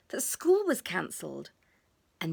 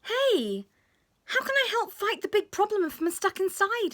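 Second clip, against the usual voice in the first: put-on much higher child voice, indignant; a little boy objecting to being locked in; protesting child; 12 semitones higher, 4 dB louder than usual. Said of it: "he asked".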